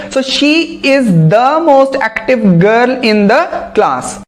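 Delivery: very slow, word by word example; carefully dictated English sentence; slow dictation. Shown as a demonstration. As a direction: read the example slowly for students.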